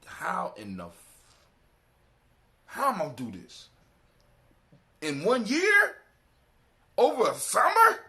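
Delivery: high, loud disbelieving cry; incredulous. Retold or imitated. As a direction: shout it in disbelief. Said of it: "And I'm like".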